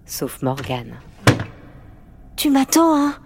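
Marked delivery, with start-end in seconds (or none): 2.4-3.1 high, loud, needy little voice; anxious pleading. A narrator's reading. Morgane, afraid of the dark, asks her friend to wait, anxious. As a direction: ask anxiously for reassurance, pitched high.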